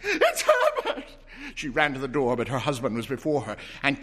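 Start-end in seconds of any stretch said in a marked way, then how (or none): 0.0-1.0 very high, slow, loud wail; drawn-out scream. Acted. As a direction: stretch the name out in a desperate shriek. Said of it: "she screamed".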